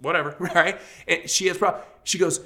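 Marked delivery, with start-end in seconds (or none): 0.0-0.4 much softer grudging mutter; sulky mutter. Retold or imitated. Husband muttering a grudging reply to the counselor. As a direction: mumble it under your breath, unwilling. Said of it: "I'm just like".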